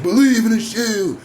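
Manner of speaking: hammed-up Shield catchphrase; mock wrestling catchphrase. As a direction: belt it like a cheesy entrance line. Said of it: "It's like".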